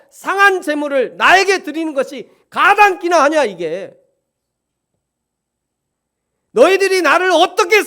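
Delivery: fiery preaching of divine anger; God's anger. Acted. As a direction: thunder out God's indignation. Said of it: "하나님이 분노하시는 하나님을 보여주고".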